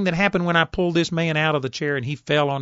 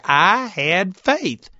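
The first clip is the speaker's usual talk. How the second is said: emphatic; 4 semitones higher, much slower than usual. Real, not acted. Preacher repeats for emphasis.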